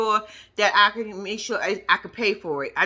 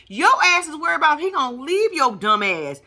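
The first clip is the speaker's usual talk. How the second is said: ordinary talk, nothing marked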